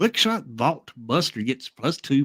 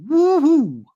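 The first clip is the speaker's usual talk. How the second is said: cheer; 13 semitones higher, 4 dB louder than usual. Acted. Cheering a double strike card.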